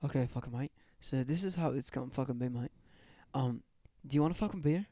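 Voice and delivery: laid-back mock Aussie bloke; light put-on Australian accent. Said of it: "talk like a fucking Australian".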